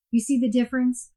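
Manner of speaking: normal talking voice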